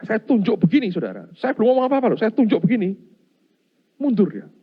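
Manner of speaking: high, loud, triumphant telling; preacher relishing the ghost backing off; dramatic climax. Real, not acted. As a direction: punch each beat like a revelation.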